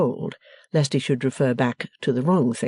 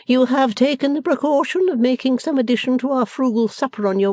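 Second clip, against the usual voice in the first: higher-pitched ceremonious complaint; stately matron; 10 semitones higher, 3 dB louder than usual. A narrator's reading. Missus Wilfer on her frugal supper, loftily reproachful. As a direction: disparage the supper grandly, pitched high.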